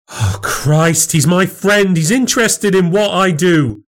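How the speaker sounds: high, indignant defence; exasperated protest. Acted. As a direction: defend your friend to your husband. Wife protests in quarrel.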